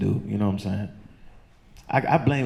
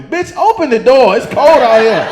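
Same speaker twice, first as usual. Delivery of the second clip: loud, high, slow shout; furious shout through a store door; angry yell; 12 semitones higher, 11 dB louder than usual. Retold or imitated. Yelling at closed store worker.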